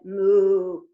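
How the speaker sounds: loud mock moo; cow moo. Cow moos in story.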